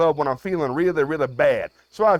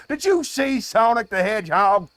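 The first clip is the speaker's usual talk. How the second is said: raised, exasperated rant; worked-up yelling; 4 semitones higher than usual. Acted. Host rants to a Zoomer about Sonic, yelling. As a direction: exclaim in mounting disbelief. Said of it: "perhaps I'm yelling too much".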